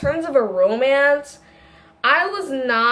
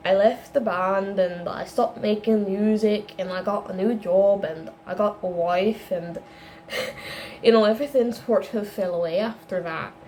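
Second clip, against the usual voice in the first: put-on a tentative Irish accent, softer and lower; 6 semitones lower, 4 dB quieter than usual. Boyfriend telling Dandelion about his past, attempted Irish accent. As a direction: try an Irish lilt for the Scottish boyfriend, unsure. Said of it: "I'm gonna go Irish for him".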